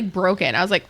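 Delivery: ordinary delivery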